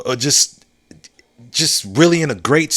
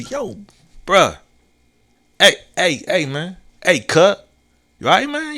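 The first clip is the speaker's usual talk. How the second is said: buddy greeting; 4 semitones higher than usual. Retold or imitated. Men greeting a happy man.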